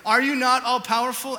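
booming rhetorical question; preached appeal. Shown as a demonstration. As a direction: cry it out to God with force. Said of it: "Jehoshaphat's second appeal".

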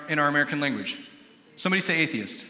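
ordinary delivery

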